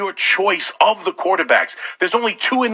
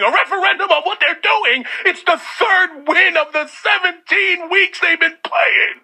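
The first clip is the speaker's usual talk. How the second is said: incredulous scoff; 8 semitones higher than usual. Real, not acted. Host mocking a guest's referendum claim in disbelief. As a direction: throw the word back at him in disbelief.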